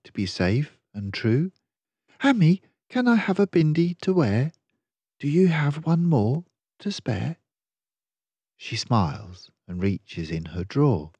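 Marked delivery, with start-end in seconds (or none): 2.2-7.4 put-on high, fast, eager little-boy asking; boy asking mum for a bindi; eager child. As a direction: beg sweetly like an excited child.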